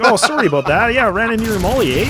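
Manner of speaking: polite Canadian. Retold or imitated. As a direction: apologise like an overly nice Canadian. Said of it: "Typical Canadian fashion".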